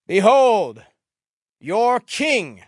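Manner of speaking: loud, high, with a pause midway; grand proclamation. A narrator's reading. Pilate presenting Jesus from the judgment seat, loud proclamation. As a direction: herald the king to the crowd.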